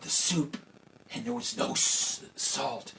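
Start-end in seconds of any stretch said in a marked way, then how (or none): none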